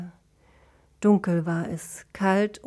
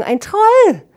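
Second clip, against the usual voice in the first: high, loud cry of alarm; panicked high scream; startled cry; 14 semitones higher, 11 dB louder than usual. A narrator's reading. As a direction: shriek the warning in fright. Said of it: "rief Klee erschrocken".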